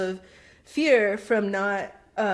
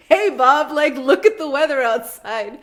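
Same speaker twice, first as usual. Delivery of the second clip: mock NPC voice; 6 semitones higher, 9 dB louder than usual. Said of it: "trying to pretend like I'm an N P C".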